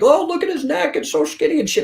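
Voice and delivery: high, mocking sneer at his skinny neck. Mocking people who jeer at his skinny neck, high sneer. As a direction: mimic the critics in a high jeer.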